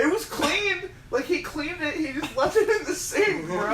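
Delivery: high-pitched, tickled defence; real amused disbelief; laughing protest. Real, not acted. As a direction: squeal it out while cracking up. Protesting through the group's laughter.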